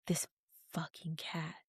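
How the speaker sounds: whispered, annoyed curse at the cat; quoting the man cursing the cat in a whisper; muttered curse. Retold or imitated. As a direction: hiss it under the breath. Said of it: "And now he's like".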